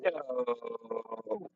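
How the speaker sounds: restrained mock scream at the sky; long held "no", volume kept down; hammed-up cry to the heavens, held back. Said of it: "I'm, like, screaming at the sky".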